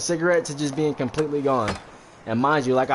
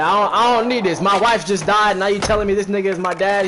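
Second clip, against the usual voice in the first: higher, louder imitated upset; acted distraught outburst; mock crying; 6 semitones higher, 5 dB louder than usual. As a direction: protest loudly as if upset, pitched up. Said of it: "he started crying".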